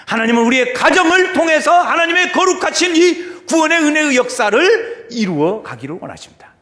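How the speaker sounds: high, loud proclamation; full-force preaching. Acted. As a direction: declare it with rising fervour to the congregation.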